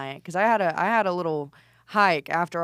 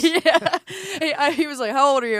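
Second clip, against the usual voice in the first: higher, louder through laughter; laughing; 8 semitones higher, 6 dB louder than usual. Real, not acted. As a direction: laugh while quoting the question. Laughing retelling age question.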